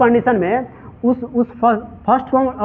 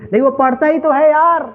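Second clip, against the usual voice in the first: higher, punching पढ़ता ही तो है; emphatic insistence; 5 semitones higher than usual. Shown as a demonstration. Teacher models stressing the action for the class, insistent. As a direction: say it like he really does read. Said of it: "जोर देना चाहेंगे".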